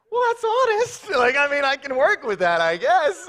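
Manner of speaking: high, loud mock bewilderment; hammed-up dad thrown by an honest answer; baffled reaction. Retold baffled reaction to daughter.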